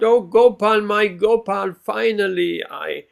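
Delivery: loud, high cry of longing, voice lifted; joyful calling out. Retold or imitated. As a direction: call out to a beloved you finally found. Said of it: "he called out".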